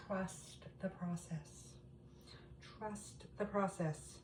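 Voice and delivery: slow and soft, easy does it; calm reassurance. Real, not acted. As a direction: soothe the doubters slowly. Reassuring viewers doubting the method.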